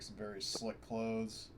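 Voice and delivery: usual speaking voice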